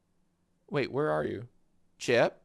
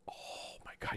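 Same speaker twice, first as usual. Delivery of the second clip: whisper; 16 dB quieter than usual. Real, not acted. Reacting to dog yawn.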